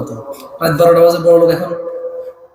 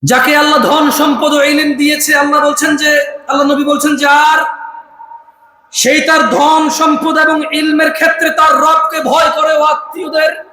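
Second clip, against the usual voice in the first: full-force preaching; 7 semitones higher than usual. Acted. Preacher drilling the gathering in a hadith, full-force preaching. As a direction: preach it out, calling for answers.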